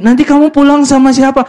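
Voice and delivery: mock boyfriend reasoning with her; pleading boyfriend. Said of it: "Ya, cowoknya mikir".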